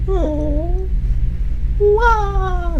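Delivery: very high cartoon Italian wail; funny high Italian voice; Mario. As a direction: squeal a drawn-out cartoon wah. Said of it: "it's the Mario wah".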